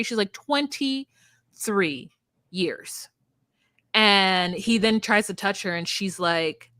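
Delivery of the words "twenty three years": Twenty-three stretched out with weight; slow emphatic correction. Retold or imitated. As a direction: spell out the years slowly. Correcting the father.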